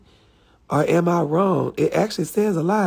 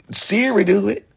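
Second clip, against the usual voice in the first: ordinary talk, nothing marked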